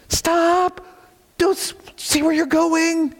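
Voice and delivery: high urgent shout of alarm; warning yell. Pretend. Pastor yelling a warning at someone backing into traffic, high urgent shout.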